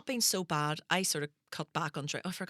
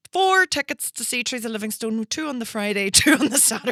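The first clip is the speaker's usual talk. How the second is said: proud mum; 5 semitones higher, 8 dB louder than usual. Retold or imitated. Proud mum at box office.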